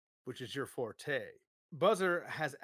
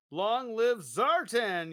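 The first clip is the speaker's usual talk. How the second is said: slow, loud salute cry, higher; 6 semitones higher, 6 dB louder, much slower than usual. Acted. Soldier crying out a salute to Zartan, slow and loud. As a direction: proclaim it like a sworn follower. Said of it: "you cry".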